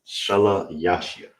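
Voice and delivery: shalayashya said slowly, syllable by syllable; Hebrew word for third. Shown as a demonstration. Teacher models the Hebrew word slowly for students. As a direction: sound out shalayashya carefully. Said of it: "in the Hebrew is shalayashya".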